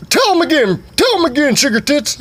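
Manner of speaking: hammed-up high daddy voice; father voice.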